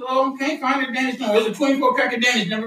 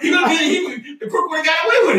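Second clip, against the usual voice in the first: laughing shout; 6 semitones higher, faster than usual. Real, not acted. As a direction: crack up and blurt it out. Dad laughing at son's slip.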